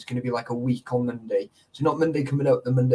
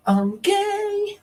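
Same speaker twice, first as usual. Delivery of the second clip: mock camp. Punchline after the sung lines.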